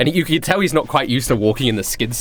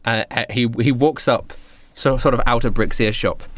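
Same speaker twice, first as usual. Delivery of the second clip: ordinary talk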